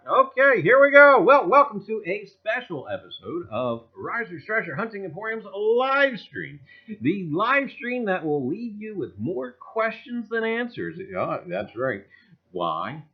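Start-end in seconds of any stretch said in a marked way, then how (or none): 0.1-11.0 show intro. Acted.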